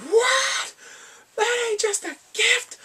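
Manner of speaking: loud, drawn-out falsetto shriek; mock shock. Online friends learning the gift's brand, hammed-up shock. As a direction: shriek it high in exaggerated disbelief.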